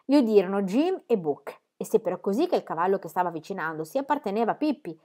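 ordinary delivery

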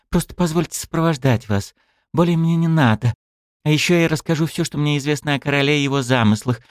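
put-on high, hopeful young woman; hopeful plea. A narrator's reading. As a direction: plead hopefully for a place. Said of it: "С надеждой в голосе".

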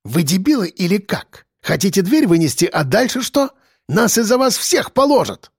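high-pitched, panicky scolding; alarmed, indignant young man's rant; agitated scorn. Acted. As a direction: berate them as idiots, pitch climbing. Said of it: "вдруг зашипел мажор".

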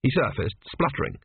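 ordinary delivery, nothing marked

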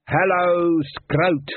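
high, drawn-out stage yell. A narrator's reading. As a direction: yell a big rock-star greeting to the town. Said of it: "He yelled".

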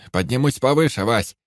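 loud high call over the engine; shouting over engine roar. A narrator's reading. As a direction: shout it over the roaring motor. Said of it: "Перекрикивая натужный рёв двигателя".

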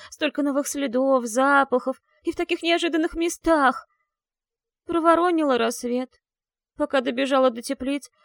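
put-on rambling, mortified werewolf girl; embarrassed young girl. Sophie's character voice.

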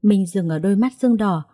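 ordinary delivery, nothing marked

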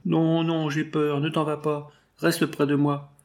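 cling to mother, begging her not to leave; frightened child. A narrator's reading. Little boy begs his mother not to go, frightened.

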